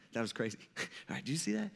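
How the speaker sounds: low, hushed chuckling aside; easing up. Acted. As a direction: murmur it with a small relieved laugh. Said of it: "getting a little easy, like".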